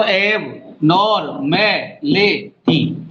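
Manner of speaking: एब नॉर में ले टी split, slowing at the end; syllable count. Shown as a demonstration. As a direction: count out each piece. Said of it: "हो गया ना?". (शब्दांश गिनकर)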